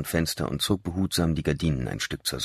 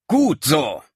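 loud, much higher cheer; shouted; 10 semitones higher, 7 dB louder than usual. Acted. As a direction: call out in triumph, brandishing the pistol. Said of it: "rief sie".

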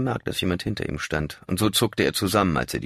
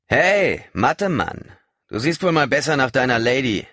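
loud, higher, sneering taunt; biting mockery; 8 semitones higher, 6 dB louder than usual. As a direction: jeer at him to wound. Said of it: "in beißend spöttischem Ton".

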